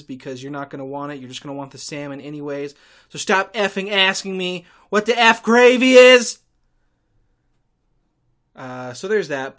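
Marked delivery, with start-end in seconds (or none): 3.1-6.4 much higher and louder, fed-up shouting; exasperated shouted rant; exasperated yell. Acted. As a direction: blow up at the nagging customers. Ranting at imagined tour customers.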